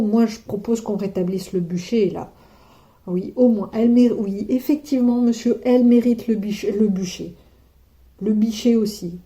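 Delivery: loud, hammed-up call for the stake; mock outrage. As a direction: demand the bonfire with fake fury.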